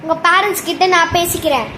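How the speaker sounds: ordinary delivery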